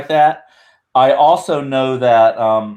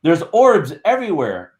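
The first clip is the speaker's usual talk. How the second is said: lightly acted, higher-pitched quote; mild mock astonishment, pitched up; retold exclamation; 8 semitones higher than usual. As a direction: quote him with raised pitch and a touch of amazement. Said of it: "he goes".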